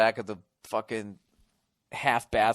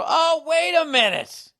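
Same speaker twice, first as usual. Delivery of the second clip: sudden realization; 8 dB louder, slower than usual. Real, not acted. Suddenly remembers a team.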